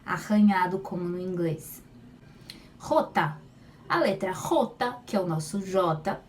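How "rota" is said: "Rota" with a throaty scraped j sound; scraped jota. Shown as a demonstration. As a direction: start "Rota" with a raspy jota. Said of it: "arranhada como re".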